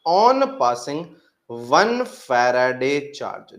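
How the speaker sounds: slow, stressed dictation; slow emphatic dictation. Shown as a demonstration. Teacher dictating the basic law to students, slowly. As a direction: lay each word down for note taking. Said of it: "सुनिएगा ध्यान से".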